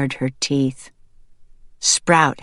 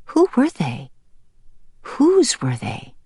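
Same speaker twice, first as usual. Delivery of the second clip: wondering. A narrator's reading. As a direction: wonder aloud, pitch falling through each question. Startled bird wonders about strange babies.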